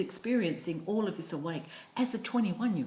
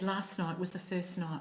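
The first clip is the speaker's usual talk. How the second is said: ordinary delivery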